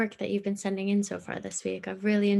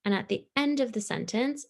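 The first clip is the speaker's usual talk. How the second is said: usual speaking voice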